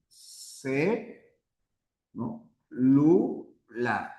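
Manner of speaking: "C, Lu, la" broken into separate syllables. Shown as a demonstration. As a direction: say "C... Lu... la" with a pause after each syllable. Divide celular en sílabas al anotar. ("C, Lu, la" sílaba por sílaba, con pausas)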